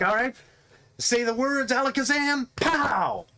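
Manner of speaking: hammed-up magic words. Magician casting spell on stage.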